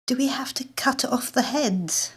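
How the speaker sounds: high frightened whisper; cringing whisper. A narrator's reading. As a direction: shrink from the idea. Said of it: "I cringe".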